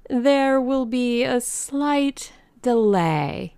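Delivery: high, slow, drawn-out announcement; slick announcer. A narrator's reading. Mister Thurkell breaks bad news to the ladies, drawn-out and high.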